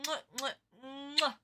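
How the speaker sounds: blown kisses. Real, not acted. Blowing kisses to the viewer.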